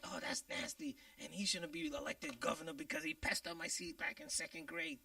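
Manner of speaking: high, breathy tattling classmate; lightly voiced whining accuser; whiny classmate. Classmate holding grudge, acted quote.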